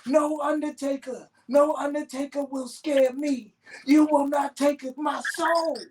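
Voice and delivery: high falsetto defiance of the Undertaker; hammed-up falsetto, warding off evil; mock exorcist. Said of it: "take the cross out and say".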